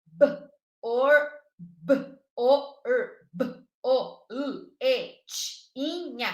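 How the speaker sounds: "B, or, b, o, r" as isolated short sounds with gaps; phoneme by phoneme. Shown as a demonstration. Teacher showing phoneme awareness, "b, o, l, e, t, inha" sounded separately. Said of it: "E consciência de fonemas".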